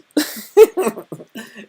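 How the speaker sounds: loud high cackle of real laughter; burst of laughter. Real, not acted. Streamer cracking up at his own story, loud laughter. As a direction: crack up at your own bit.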